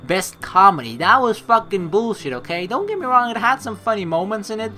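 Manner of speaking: loud exasperated rant; loud indignant rant. Real, not acted. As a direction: rant at the rigged awards. Loud rant about the Oscars.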